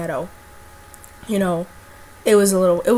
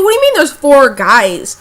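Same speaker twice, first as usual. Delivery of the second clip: loud, higher alarmed demand; alarmed question; 5 semitones higher, 10 dB louder than usual. Retold or imitated. To friend who reports four guys, loud alarmed question.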